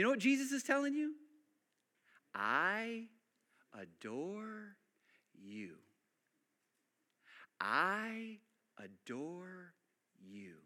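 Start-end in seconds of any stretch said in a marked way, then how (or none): 2.3-5.7 very soft, drawn-out "adore"; almost whisper it, lovingly; hushed tender. Acted. Preacher softly voicing Jesus to the congregation. 7.6-10.5 very soft, drawn-out "adore"; almost whisper it, lovingly; hushed tender. Acted. Preacher softly voicing Jesus to the congregation.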